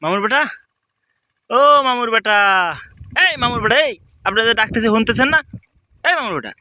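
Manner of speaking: high call across a distance. Acted. Young man calling out to the Barishal man.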